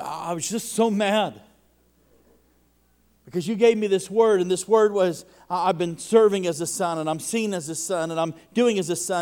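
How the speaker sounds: loud, high, impassioned complaint; preacher voicing a man furious over a word; retold angry outburst. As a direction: shout it out like the frustrated guy. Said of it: "He said".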